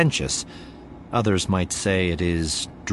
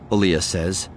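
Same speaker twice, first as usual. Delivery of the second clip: plain reading voice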